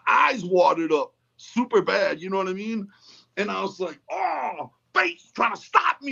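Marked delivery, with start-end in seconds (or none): 4.1-6.1 high-pitched mock protest at fate; retold indignant wail; dramatic outcry. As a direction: cry it out high, as if defying fate. Retelling his outcry at the wind.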